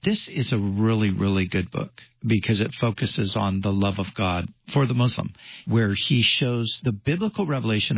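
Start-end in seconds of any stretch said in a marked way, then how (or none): none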